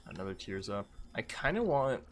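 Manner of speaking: ordinary delivery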